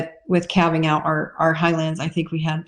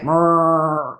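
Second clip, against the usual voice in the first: cow moo. Retold or imitated. Mama cow mooing while she eats hay.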